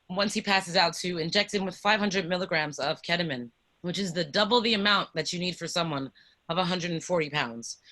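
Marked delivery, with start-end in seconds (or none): none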